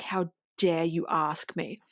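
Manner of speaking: slow whispered affront; menacing whisper. Retold or imitated. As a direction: whisper it slowly as a silent threat. Voicing Lisa's furious stare.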